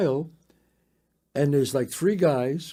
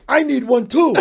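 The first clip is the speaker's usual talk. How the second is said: excited; 8 semitones higher, 7 dB louder than usual. Retold or imitated. Friend's eager reply, retold.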